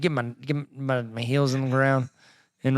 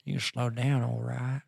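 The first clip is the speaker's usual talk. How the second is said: Matthew McConaughey; 3 semitones lower, 8 dB quieter than usual. Retold or imitated. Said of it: "like, slowing it down".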